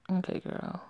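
unvoiced whisper. Real, not acted. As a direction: breathe it out softly. Aside to a character.

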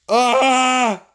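high, loud yell; battle yell. Real, not acted. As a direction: yell while charging to attack. Battle yell while attacking.

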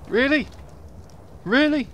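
sharp high exasperated cry; exasperated disbelief. Real, not acted. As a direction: squeak it out in disbelief. Exasperated at game death.

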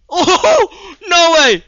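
high laughing shout; excited shriek. Real, not acted. Gamer in a close fight, high laughing shout.

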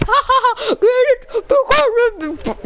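laughing at own joke; high giggly delivery. Real, not acted.

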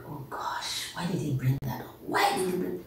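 hushed, quick whispered worry; whispered dismay. Acted. Accusers caught out by Jesus, hushed dismay. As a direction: mutter it, caught out. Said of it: "They were like".

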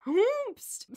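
high hooted echo of astonishment; mock shock. Host echoing co-host over the secret daughter, mock shock. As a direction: hoot it back, hammed-up and incredulous.

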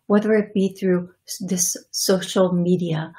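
measured channeling in a vaguely European accent; channeled ancient accent. Real, not acted. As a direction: speak as an ancient being with a faintly European accent. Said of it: "Is that a European accent".

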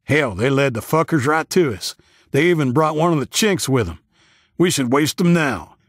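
high, heated hillbilly rant; agitated hostility. A narrator's reading. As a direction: argue hotly for violence. Hillbilly angrily urges killing the newcomers.

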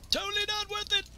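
very high put-on voice; extremely high, playful lament; squeakier comic cry. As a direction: push it way up into a silly squeal. Repeats it in higher put-on voice.

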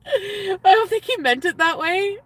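high, loud laughing voice. Real, not acted. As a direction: talk through a real giggle. Laughing at her date's remark.

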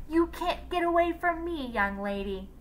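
high holler dropping on the last words. A narrator's reading. Teacher hollers after her fleeing former student. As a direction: call it out like a scolding teacher. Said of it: "she hollered".

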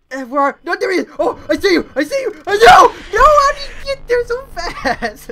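high, loud crying panic; panicked screaming. Real, not acted. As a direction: scream at the monster. Chase in game.

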